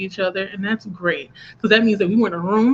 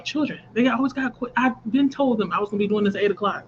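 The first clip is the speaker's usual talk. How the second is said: ordinary talk, nothing marked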